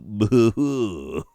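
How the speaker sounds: lightly voiced plummy royal sounds, rising at the end; a hint of a stuffy aristocrat; posh British. Saxe-Coburg royals learning English, mock posh British. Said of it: "learned English and were like".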